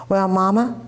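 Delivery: usual speaking voice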